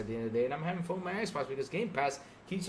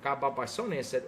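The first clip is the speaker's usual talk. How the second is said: usual speaking voice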